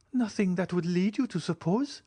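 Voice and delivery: put-on quick, high young woman's question; pressing question. Julie presses the count quickly, high questioning voice. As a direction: fire it back fast.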